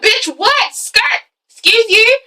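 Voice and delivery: high-pitched, hammed-up disbelief; mock outrage. Boyfriend reacting to girlfriend's confession, mock outrage. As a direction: squawk it high, as if scandalised. Boyfriend's shocked reply in re-enactment.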